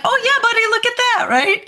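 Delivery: put-on high, sing-song reassurance to a child; encouraging mom. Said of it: "And I'm like".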